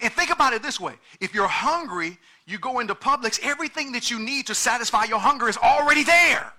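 loud, high, driving preacher's cry; preaching at full force. Acted. Pastor pressing the congregation on currency, full-force preaching.

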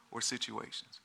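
usual speaking voice

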